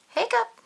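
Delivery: high, loud squeaky hiccup. Acted. Pete hiccups loudly in the story. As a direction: pop out a sudden hiccup.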